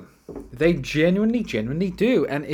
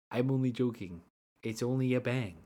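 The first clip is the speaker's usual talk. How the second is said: softer, imitated just-kidding line; mock reassurance; 8 dB quieter, faster than usual. Prankster revealing the can to beachgoers, soft mock reassurance. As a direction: back off quietly as the prankster. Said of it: "and go".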